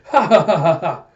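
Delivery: loud staccato cackle; hammed-up ha-ha-ha, starting high; mock villain laugh. Villain hovering, going ha ha.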